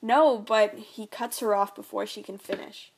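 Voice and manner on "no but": cut-off protest. Acted. Mother cut off.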